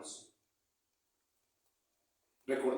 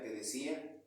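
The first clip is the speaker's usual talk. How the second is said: normal talking voice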